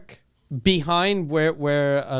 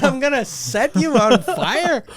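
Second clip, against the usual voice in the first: slow, higher, hammed-up menace; slow mock-scary threat; mock menacing threat; 5 semitones higher, slower than usual. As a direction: drag out the threat like a movie villain. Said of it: "God damn, that's scary".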